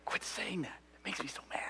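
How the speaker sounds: hushed, irritated whisper; angry whisper. Acted. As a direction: hiss it under your breath. Frustrated aside, spoken under breath.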